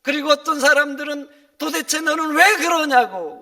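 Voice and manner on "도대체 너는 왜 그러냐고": loud, high-pitched rebuke; mock exasperated shouting; acted scolding. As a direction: tell someone off at full voice. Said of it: "야단을 칩니다".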